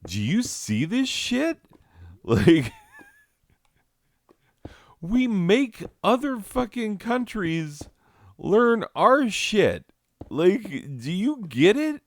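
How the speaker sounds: high, loud, giddy squeal; excited. Retold or imitated. Sharing a grammar fact, high giddy squeal. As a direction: squeal it like you can't believe it. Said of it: "I share it with them to be like".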